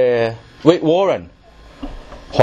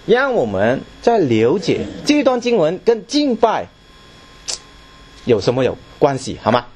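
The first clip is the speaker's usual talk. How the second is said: normal talking voice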